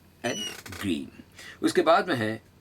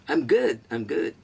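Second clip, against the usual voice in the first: cocky, higher I am good, tossed off; with attitude; 4 semitones higher, 3 dB louder, much slower than usual. Shown as a demonstration. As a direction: say it with a bit of swagger. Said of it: "चलिए, बोलिए". (थोड़े attitude के साथ)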